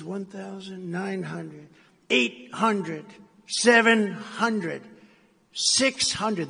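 slow, rising altitude callout; mock computer countdown. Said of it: "computer voice".

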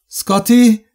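loud, high-pitched call of the name. A narrator's reading. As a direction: call out for someone out of sight. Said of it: "rief sie".

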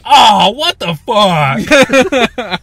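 loud, high mock-scared outburst; startled moviegoers. Imitating scared people in the cinema, startled yell. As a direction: cry out in mock shock. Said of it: "some people were like".

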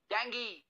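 डेंगी said "den-gee", ending in ee; correct pronunciation. Shown as a demonstration. Teacher giving the English डेंगी. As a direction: say डेंगी, not dengu. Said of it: "हम इसे कहते हैं".

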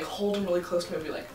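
usual speaking voice

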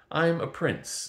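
a touch of self-pity; gloomy. A narrator's reading. Prince introduces himself to the girl, gloomy. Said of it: "said in a gloomy tone".

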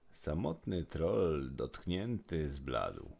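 usual speaking voice

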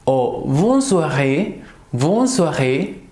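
bonne journée drawn out very slowly; very slow bonne journée, bonne soirée; slow demo. Shown as a demonstration. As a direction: stretch each syllable for learners. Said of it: "Por ejemplo".

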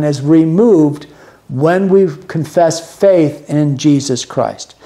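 ordinary talk, nothing marked

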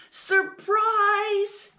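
high, loud, singsong announcement; proud cry. Acted. Little mouse surprising his mom, proud cry. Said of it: "he cried proudly".

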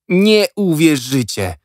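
put-on high, slow, breathless boy's voice; a kid bursting with news; boy Jack excited. A narrator's reading. Jack bursting to tell his friends, high excited boy. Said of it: "powiedział".

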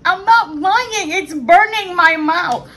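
high, loud and slow protest. Real, not acted. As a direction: insist loudly, mouth on fire. Insisting, mouth burning from spice.

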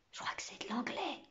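hushed whisper of a schoolboy; murmured. A narrator's reading. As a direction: whisper your guess to a classmate. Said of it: "murmura le petit Malocène". (murmure chuchoté)

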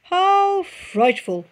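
very slow mock-dismayed fox; muttering fox. A narrator's reading. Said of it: "Foxy Loxy muttered".

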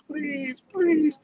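high pleading please; mock begging. Student on his knees begs the examiner, high pleading. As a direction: beg high like a panicking student. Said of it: "he's like".

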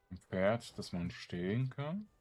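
ordinary talk

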